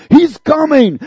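high, loud urgent shouting; shaking people awake, shouting loud and high. Retold or imitated. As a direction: shout the news to wake everyone. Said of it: "I'm crying out".

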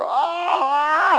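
high mock scream of terror; hammed-up panicked shriek. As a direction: shriek like a frightened sailor.

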